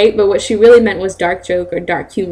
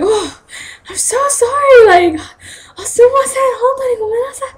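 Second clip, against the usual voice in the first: high squeaky mock apology, edged with laughter; hammed-up fluster, half laughing; flustered sensei. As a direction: imitate a teacher apologizing profusely, voice way up. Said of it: "my sensei got so flustered".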